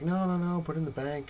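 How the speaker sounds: lightly voiced nagging partner; insistent fiancée.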